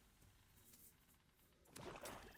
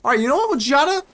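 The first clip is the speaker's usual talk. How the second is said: exasperated realisation; 11 semitones higher, faster than usual. Real, not acted.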